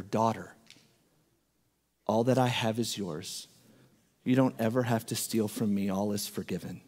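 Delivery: soft, gentle fatherly voice; tender forgiveness. Retold or imitated. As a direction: reassure the daughter quietly.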